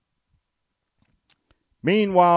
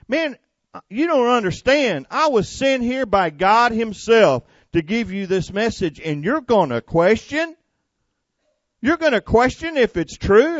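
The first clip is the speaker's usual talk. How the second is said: high, loud, indignant scolding; offended angel; 8 semitones higher, 4 dB louder than usual. Retold or imitated. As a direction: scold the doubter for questioning God. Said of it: "he was offended for the Lord".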